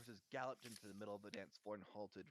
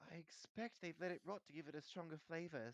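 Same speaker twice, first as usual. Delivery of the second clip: higher-pitched, lightly voiced know-it-all; knowledgeable girl; 7 semitones higher than usual. A narrator's reading. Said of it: "said Hermione knowledgeably".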